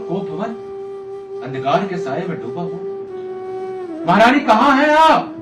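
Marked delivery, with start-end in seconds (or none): 4.1-5.3 loud high call into emptiness. Acted. King searching the dark chamber for his queen, calling out. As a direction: raise the voice to find someone.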